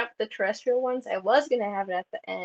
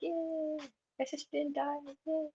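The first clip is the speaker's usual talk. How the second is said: high, soft mock cheer; sarcastic yay; 7 semitones higher, 8 dB quieter than usual. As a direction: chirp it like a fake celebration. Quoting the sister's cheer.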